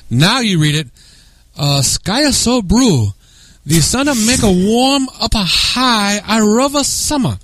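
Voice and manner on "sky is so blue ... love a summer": higher, slow broken English; mock Japanese accent. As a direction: read it in a hammed-up Japanese accent. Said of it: "read it the wrong way".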